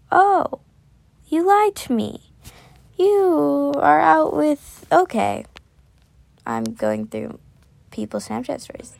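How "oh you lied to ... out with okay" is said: surprised. Real, not acted. Reacting to Snapchat story.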